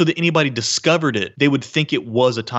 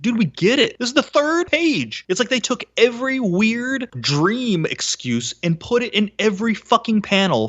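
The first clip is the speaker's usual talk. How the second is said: exasperated; 7 semitones higher than usual. Real, not acted. Host fed up with the comic's repeated dream excuses, exasperated.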